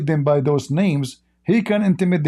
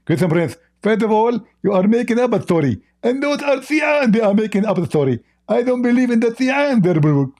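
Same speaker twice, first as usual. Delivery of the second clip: higher, lightly mocking preacher voice; Zakir Naik impression; 5 semitones higher than usual. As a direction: rebut him in a raised, mock-lecturing tone. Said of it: "Zakir, how you talk?".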